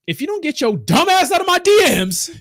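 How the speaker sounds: high-pitched mock outburst; mock shout. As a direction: blast him out of your DMs. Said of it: "I'm like".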